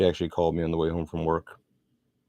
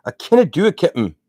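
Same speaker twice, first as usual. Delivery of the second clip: loud, high Scottish cry; hammed-up Scottish engineer protesting in desperation; Scotty, Scottish accent; 12 semitones higher, 10 dB louder than usual. Star Trek engineer protests to his captain, exclaimed in a Scottish accent. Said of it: "original series Star Trek".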